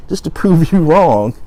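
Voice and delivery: laughing emphasis. Retold or imitated. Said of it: "my friend said".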